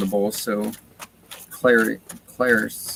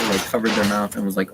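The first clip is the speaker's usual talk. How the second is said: ordinary talk, nothing marked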